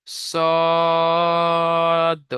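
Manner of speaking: সদ with rounded lips, drawn very long; rounded long sad. Shown as a demonstration. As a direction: round the mouth and stretch সদ. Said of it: "তিন আলিফ টান হবে". (মুখ গোল করে লম্বা টেনে সদ)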